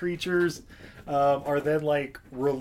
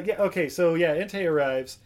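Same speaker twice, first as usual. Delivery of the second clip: ordinary delivery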